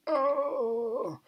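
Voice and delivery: high, held mock moan; long wailing "oh", put on in imitation; imitated patient moan. Mimicking the noisy person across the ward, mock moan. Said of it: "that person across the ward that's like".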